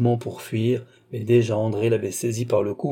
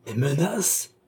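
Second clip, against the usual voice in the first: indignant rising question; proud outcry; 3 semitones higher, slower than usual. A narrator's reading. Proud sister challenging her threatening brother, proud outcry. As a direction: throw the word back, proudly. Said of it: "s'écria la fière André".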